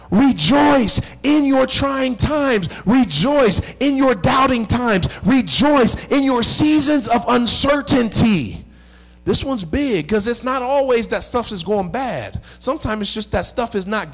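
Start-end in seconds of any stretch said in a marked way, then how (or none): 0.1-8.6 slow, emphatic preaching cadence. Acted. Pastor urging the church to rejoice, slow and emphatic. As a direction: lift each rejoice to the congregation.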